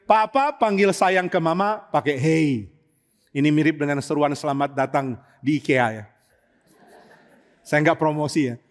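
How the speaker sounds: ordinary talk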